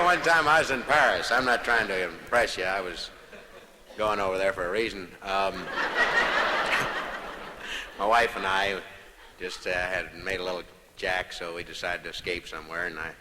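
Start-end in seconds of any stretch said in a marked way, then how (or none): 5.6-7.9 silent breathy giggle falling away; a high wheezing giggle; real giggle; high wheezy laugh. Real, not acted. Long laugh.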